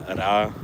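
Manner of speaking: ra with a trilled Spanish R; rolled R. Shown as a demonstration. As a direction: roll the R in ra. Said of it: "R A is like".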